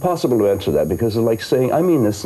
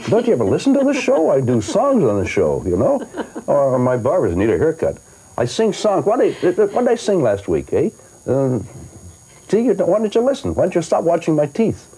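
mock indignant; 9 semitones higher than usual. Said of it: "my dentist used to watch and say".

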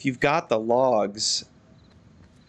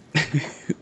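short airy laugh under the breath; breathy chuckle; 4 semitones higher than usual. Real, not acted. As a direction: laugh at his own joke. Joking about gentrification in game.

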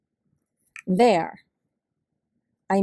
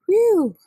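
relieved whew; 9 semitones higher, 5 dB louder than usual. Acted. Relieved exhale.

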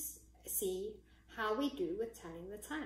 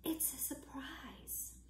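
slow, hushed whisper; storybook whisper of a secret; 4 semitones higher, 8 dB quieter, slower than usual. A narrator's reading. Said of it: "whispered Rabbit".

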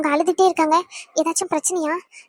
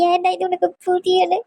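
ordinary talk

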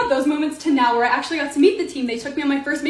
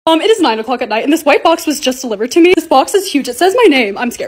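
ordinary delivery

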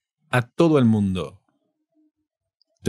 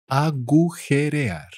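"Agujerear" stretched syllable by syllable, higher; 5 semitones higher than usual. Shown as a demonstration. Teacher repeats word drawn out.

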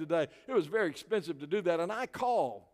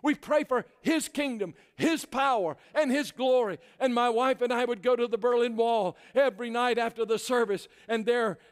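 high, loud, emphatic sermon delivery; impassioned preacher at the climax; full-force preaching; 7 semitones higher, 6 dB louder than usual. Real, not acted. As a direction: drive each phrase home from the pulpit.

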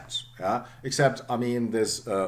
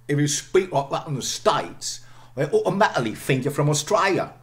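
a light Cockney accent, a bit raised and louder; Cockney demo; 7 semitones higher, 5 dB louder than usual. Shown as a demonstration. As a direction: read it like a Londoner, glottal stops for the t's. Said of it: "very Cockney".

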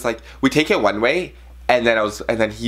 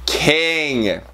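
flirty drawn-out King; 4 semitones higher than usual. Pretend. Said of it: "I can't believe I said that".

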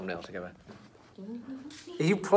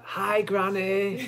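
drawn-out, slightly raised greeting; sheepish greeting; 4 semitones higher, much slower than usual. Acted. Purple greets Granny at the rehearsal, drawn-out hello. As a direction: stretch out an awkward hello. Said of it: "And she's like".

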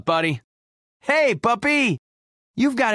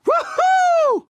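very high, loud "woohoo"; exhilarated whoop; 5 dB louder than usual. Acted. Pilot loving the ride, exhilarated whoop. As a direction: whoop at the thrill of flight.

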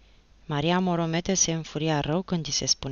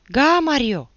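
loud, high hailing cry; exclamation; 10 semitones higher, 11 dB louder, slower than usual. A narrator's reading. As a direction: burst in calling out to aunt. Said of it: "exclamă el". (exclamație tare, înaltă)

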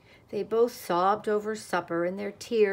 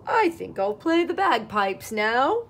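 louder, lilting duck declaration; bright announcement; 3 semitones higher, 5 dB louder than usual. A narrator's reading. Duck breaking the peace, bright announcement. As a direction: announce it loudly as a punchline. Duck's cheeky final announcement.

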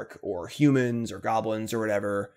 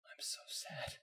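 soft unvoiced whisper; whispered sadness; 16 dB quieter, slower than usual. Real, not acted. Gamer mourning his lost game, whispered. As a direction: breathe it out, defeated.

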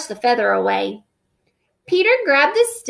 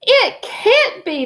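high, slow, disbelieving splutter; a shocked king stammering in disbelief; sputtering; 9 semitones higher, 4 dB louder, slower than usual. Acted. King seeing his six noses, high sputtering. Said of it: "the king sputtered".

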